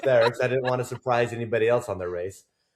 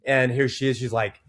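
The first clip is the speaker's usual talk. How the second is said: ordinary delivery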